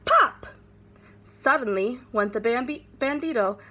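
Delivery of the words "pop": very high, sharp popping sound; loud pop sound effect. A narrator's reading. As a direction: burst it out like a balloon.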